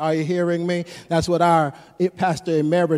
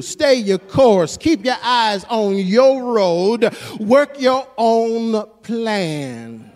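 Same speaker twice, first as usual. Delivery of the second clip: slow emphatic exhortation; 3 semitones higher, 4 dB louder, much slower than usual. Acted. Preaching exhortation.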